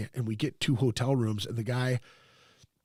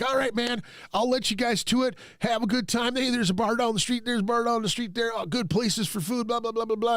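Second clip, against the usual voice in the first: higher, breezy host impression; imitating the host; 8 semitones higher than usual. Mimicking the host chatting to the guests on his way out, higher voice. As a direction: rattle it off like the chatty Airbnb host. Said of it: "He's like".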